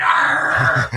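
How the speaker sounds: loud pirate arr; pirate shout. Pretend. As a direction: bellow it like a pirate. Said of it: "I tried".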